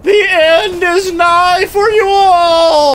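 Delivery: high, slow shouted doom warning; doomsday street preacher. Pretend. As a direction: preach the end loudly.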